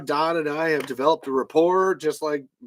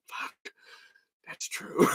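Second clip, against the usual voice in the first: whispered, much softer, unvoiced; stunned mutter; 9 dB quieter, much slower than usual. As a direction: breathe it out in defeat. [name]'s stunned muttered reaction.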